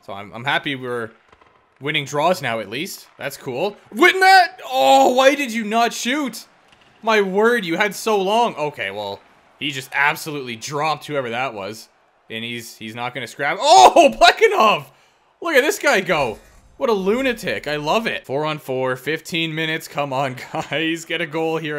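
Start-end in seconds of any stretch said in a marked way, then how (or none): 3.9-8.6 loud high cry, then slow disbelieving scolding; real frustrated shouting; exasperated yell. Real, not acted. Teammate didn't shoot. 13.6-17.7 loud high laughing shout; real excited cheering; delighted outburst. Real, not acted. Watching a big play.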